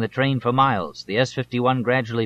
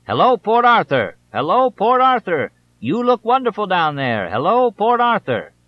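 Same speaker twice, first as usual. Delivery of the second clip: much higher, louder megaphone yell; yelling through a megaphone; 10 semitones higher, 6 dB louder than usual. A narrator's reading. Candidate greeting the city from the helicopter, megaphone yell. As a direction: bellow it down to the whole city. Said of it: "yelling down through a megaphone".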